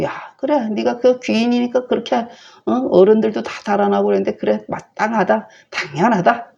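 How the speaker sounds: a father jeering at his son; sneering sarcasm. Retold or imitated. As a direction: ridicule him with exaggerated agreement. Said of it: "빈정대는 거죠".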